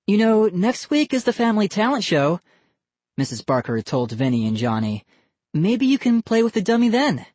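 high friendly woman voice; bright motherly suggestion; cheerful mom. A narrator's reading. Mother to boys.

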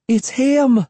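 high-pitched alarmed cry; sudden frightened exclamation. A narrator's reading. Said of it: "Cassie cried".

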